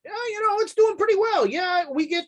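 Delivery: high, chatty barkeep voice; lightly voiced saloon keeper; bartender voice. GM voicing the bartender.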